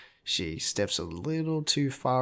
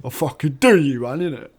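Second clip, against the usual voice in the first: louder, higher London slang voice; brash London "bruv" swagger; London street accent; 5 semitones higher, 11 dB louder than usual. Retold or imitated. Janice brags to Regina about ruining her, brash London accent. Janice quoted again, "innit.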